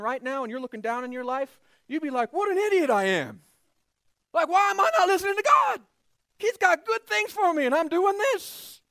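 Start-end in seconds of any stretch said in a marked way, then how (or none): 2.3-8.8 high, loud, fast self-scolding; mock exasperated shout. You scolding yourself from heaven, loud and fast. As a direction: yell at your past self.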